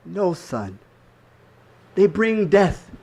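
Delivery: grave mother. Acted. Mother warning her son about the men, grave and firm. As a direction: answer the child firmly and gravely.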